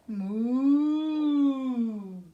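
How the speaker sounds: loud mooing cow; playful loud moo. Shown as a demonstration. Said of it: "then we can do cow".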